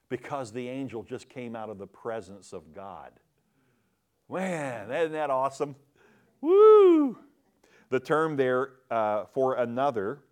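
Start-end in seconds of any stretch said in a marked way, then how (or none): 6.4-7.2 high loud excited whoop; joyful whoop. Real, not acted. Preacher whooping with joy at the angel. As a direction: let out a celebratory woo.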